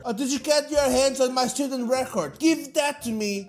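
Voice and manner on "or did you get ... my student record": ordinary delivery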